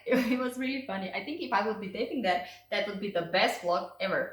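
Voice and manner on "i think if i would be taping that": normal talking voice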